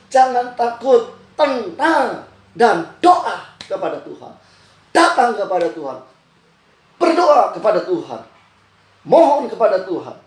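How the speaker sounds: urgent exhortation, each phrase punched; emphatic preaching. Real, not acted. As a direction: drive each command home to the congregation.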